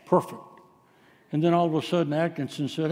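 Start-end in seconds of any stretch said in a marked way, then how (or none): none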